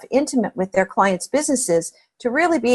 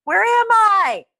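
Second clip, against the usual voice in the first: shouted call; 11 semitones higher, much slower than usual. Retold or imitated. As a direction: call down from the balloon, lost and shouting. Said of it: "and he yells down".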